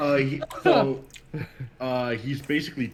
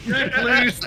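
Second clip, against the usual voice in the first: mock customer; 13 semitones higher, 8 dB louder than usual. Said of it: "quoting the movie line".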